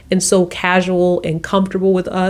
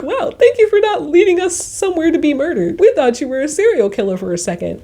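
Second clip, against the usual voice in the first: mock relieved gratitude; 9 semitones higher, faster than usual.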